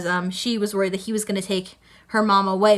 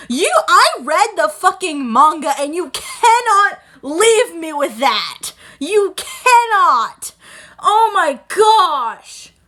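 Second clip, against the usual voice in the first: loud, high, drawn-out disbelief; exasperated fan rant, raised pitch; exasperated outburst; 6 semitones higher, 6 dB louder, much slower than usual. Real, not acted. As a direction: stretch each word in loud, dramatic protest.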